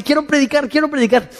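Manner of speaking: high, insistent little plea; eager Paul. Acted. Preacher voicing Paul begging to preach, high and eager. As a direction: play an eager apostle, pitched well up. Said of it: "Pablo dice".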